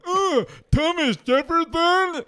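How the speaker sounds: high, slow, dopey know-it-all whine; dumb guy voice. Retold or imitated. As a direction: drag it out like a clueless guy.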